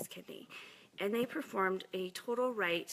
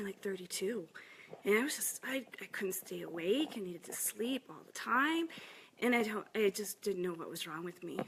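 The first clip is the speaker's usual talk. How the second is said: usual speaking voice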